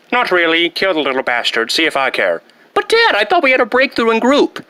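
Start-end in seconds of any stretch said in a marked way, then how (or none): 2.7-4.7 high whining plea; whiny plea. Acted. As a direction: plead with dad like a hurt kid. Son pleads with father.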